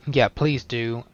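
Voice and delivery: ordinary delivery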